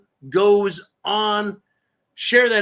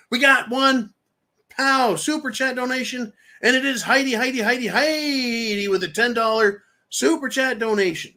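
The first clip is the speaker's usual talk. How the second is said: excited announcing; 7 semitones higher than usual. Real, not acted. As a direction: shout it out like a game-show host. Host announcing super chat donation.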